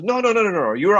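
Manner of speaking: high-pitched, imitated insistence; insistent correction. Stranger correcting him about his username, high and insistent. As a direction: mimic a stranger eagerly putting someone right.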